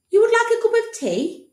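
very high puzzled doctor voice. Acted. Doctor offering the boy tea, high puzzled voice. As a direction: misunderstand earnestly.

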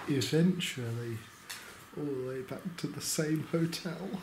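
quiet weary update at night; hushed late-night talk. Real, not acted. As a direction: keep it down, people sleeping.

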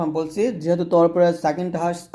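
normal talking voice

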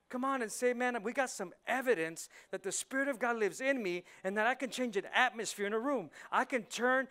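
loud, high, fervent sermon; preaching at full force. Real, not acted. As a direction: drive it home to the congregation.

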